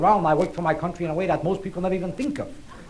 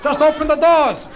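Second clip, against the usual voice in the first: mock rallying shout; 8 semitones higher, 3 dB louder, slower than usual. Mock rallying call to free prisoners.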